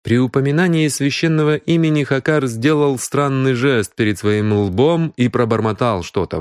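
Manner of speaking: plain reading voice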